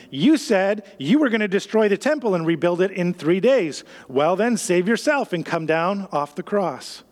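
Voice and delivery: ordinary talk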